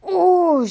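acted storybook shudder on a long ooh; shivering ooh. A narrator's reading. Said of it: "shivered Percy".

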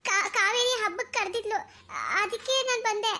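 high, hesitant stammered excuse; nervous stammer. Acted. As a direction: stumble over the first sounds, nervously. Girl caught, stammers an excuse.

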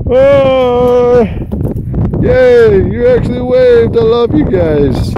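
real joy, calling up to them; delighted. Real, not acted. Greeting hikers who waved back, thrilled and high.

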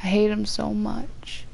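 real hate trailing off to a whisper; fading bitter mutter. Real, not acted. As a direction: let the anger drain into a murmur. Fading hatred.

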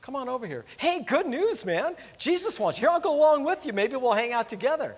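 lightly voiced Jesus welcoming him over; Jesus calling the blind man over, eager and friendly; cheerful Jesus. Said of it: "Jesus stops and goes".

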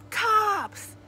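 high, loud one-word warning; alarmed shout. A narrator's reading. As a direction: yell it from the back row. Kid shouts identification from last row.

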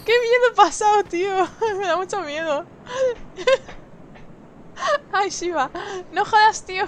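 high, loud laughing through the fright; nervous laughter. Real, not acted. Scared player laughing after a close call. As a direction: laugh off the scare, still shaken.

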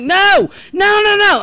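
loud, high string of no's; retold frantic protest. Daughter to mother gathering up her records, frantic protest. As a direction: cry out in mock panic.

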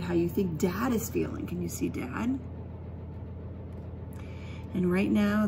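ordinary delivery

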